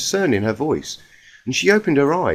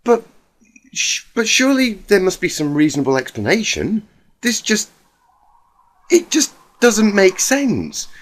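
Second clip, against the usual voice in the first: Rarity in denial; 7 semitones higher than usual. Acted. Rarity denying, shocked.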